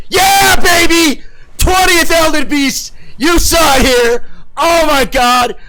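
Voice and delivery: high, loud, slow triumphant shouting; victory yell. Real, not acted. Streamer celebrating the boss kill with his viewers, ecstatic shouting. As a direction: scream the win to the chat.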